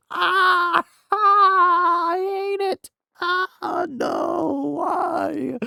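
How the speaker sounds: high, loud crying wail; anguished cry. Real, not acted. Streamer crying out over a game ending he hates. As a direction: cry out in mock agony.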